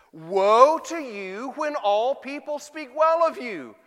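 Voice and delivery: loud, high, drawn-out warning; stern warning. Acted. Preacher quoting Jesus to the congregation, loud stern warning. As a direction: proclaim the woe slowly and forcefully. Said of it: "This is why Jesus warned".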